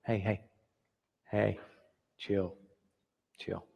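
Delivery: low, soft, soothing hush; God soothing you close to your ear; soft calming murmur. Acted. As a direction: settle someone down gently. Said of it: "leans over into your ear and goes".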